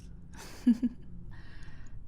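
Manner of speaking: whispered cruel giggle; breathy villain giggle. Acted. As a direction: giggle under your breath. Villain showing off victim.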